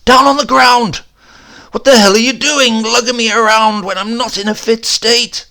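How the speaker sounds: high, loud, raging yell; injured man bellowing in fury; furious shout. Acted. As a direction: roar at the stretcher bearers in pain. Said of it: "I wanted to shout".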